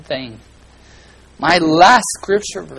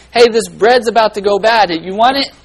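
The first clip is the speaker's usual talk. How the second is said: loud, higher call of a neighbourly seller; friendly market seller; 7 semitones higher, 9 dB louder than usual. Retold or imitated. Seller offering bread to a needy father, loud and higher. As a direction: offer the bread like a cheerful vendor.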